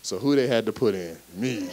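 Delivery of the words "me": laughing it out; bursting into laughter. Real, not acted. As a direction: laugh at the irony of it. Laughs at being put in.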